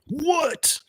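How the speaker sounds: very high, stunned disbelieving what; incredulous squeak. Retold or imitated. Recalling hearing the deadly door news, stunned high disbelief.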